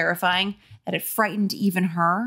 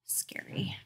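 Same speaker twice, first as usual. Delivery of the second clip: whisper; 15 dB quieter than usual. Real, not acted. Reader's aside during scary scene.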